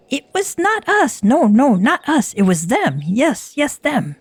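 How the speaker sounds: high, pleading kobold voice, bobbing up and down; a frantic little kobold blaming the others; squeaky kobold. Acted. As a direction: pitch it up and beg like a cornered kobold. Kobold shifts the blame.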